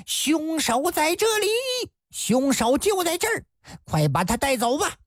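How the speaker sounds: high, urgent calling out to the constables; crying out to the approaching constables; shouting out. Acted. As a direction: raise the alarm, pointing out the culprit. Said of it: "娄阿鼠高声大喊".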